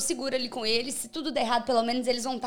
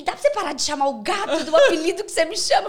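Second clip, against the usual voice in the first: higher, mock-exasperated protest; retold boyfriend's complaint; 6 semitones higher, 4 dB louder than usual. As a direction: complain like a put-out boyfriend, pitched up. (imitando namorado reclamando, voz mais aguda)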